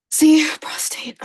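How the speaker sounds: slow whispered aside. Real, not acted. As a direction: whisper it slowly.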